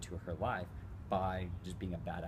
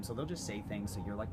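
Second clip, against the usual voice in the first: ordinary talk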